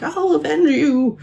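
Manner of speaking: drawn-out, very high melodramatic cry; mock scream to the sky. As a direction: wail a vow at the heavens, slowly. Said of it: "scream to the sky moment of".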